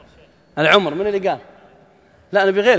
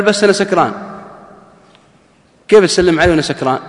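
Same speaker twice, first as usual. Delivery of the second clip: put-on loud, incredulous drunk man's objection; a retold drunk's loud protest; indignant loud protest; 3 semitones higher, 8 dB louder, faster than usual. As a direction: throw it back at him, offended and loud. Drunk man protests at greeting.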